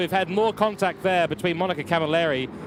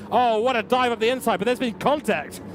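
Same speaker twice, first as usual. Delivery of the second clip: excited shout; 7 semitones higher than usual. Real, not acted. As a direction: react sharply to the dive. Sudden crash in the race.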